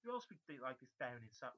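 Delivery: ordinary talk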